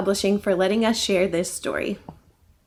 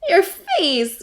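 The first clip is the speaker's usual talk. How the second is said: giggling; 7 semitones higher, 4 dB louder than usual. Acted. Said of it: "giggled Roxy".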